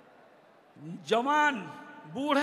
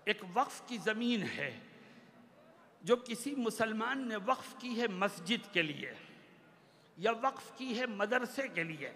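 ordinary delivery

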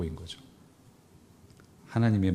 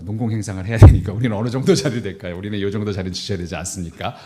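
eager disciple; 10 dB louder than usual. Retold or imitated. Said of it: "뭐 이런 식으로 얘기를 했던 거죠".

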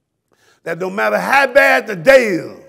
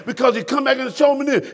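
normal talking voice

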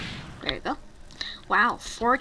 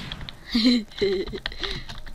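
sneaky little snicker; sly villain giggle. Pretend. Player gloats over killing a friend in game, snickering. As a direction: snicker like a villain getting away with it.

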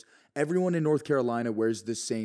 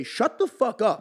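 mock angry yell; 9 semitones higher, 3 dB louder, slower than usual. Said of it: "I'll audibly say".

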